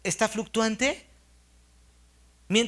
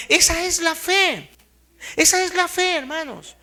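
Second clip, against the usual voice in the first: emphatic proclamation; 6 semitones higher, 5 dB louder than usual. Real, not acted. Preacher proclaiming faith to the congregation, loud and high.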